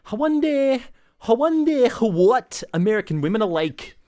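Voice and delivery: high, loud hammed-up Scottish; Scottish stage accent. Retelling a castmate's line from the musical, hammed-up Scottish. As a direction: belt it out in a mock Scottish brogue. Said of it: "a Scottish musical".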